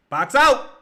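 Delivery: high, loud call to box out; shouted instruction. Real, not acted. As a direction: yell it at the players on the court. Yelling at players.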